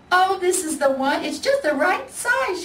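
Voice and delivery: a lady who's found just the right bear; excited high shopper voice; delighted lady. Acted. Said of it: "she said".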